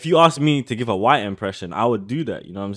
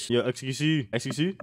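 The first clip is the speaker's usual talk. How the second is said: ordinary delivery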